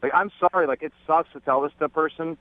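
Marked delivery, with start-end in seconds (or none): none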